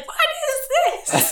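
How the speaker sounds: high, loud, drawn-out old lady mimicry; older smoker impression. Older coworker baffled by what she found, mimicked high and loud. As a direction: imitate the boisterous smoker, slow and way up high. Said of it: "That's what she sounded like".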